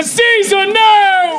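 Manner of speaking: high, loud plea; crowd scream. Acted. People of Rome scream at Caesar, high loud plea. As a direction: yell it as a desperate crowd. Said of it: "people of Rome scream".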